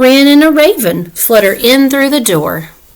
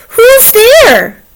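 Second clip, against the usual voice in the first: high, loud startled squeak; Mouse crying out; 13 semitones higher, 5 dB louder than usual. Acted. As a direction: cry out like a scared little mouse. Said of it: "Mouse cries".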